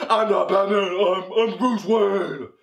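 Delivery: slow, drawn-out mock hero voice; hammed-up vigilante, very slow; Batman. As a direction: draw out the name as a deluded superhero dad. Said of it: "He pretends to be Batman".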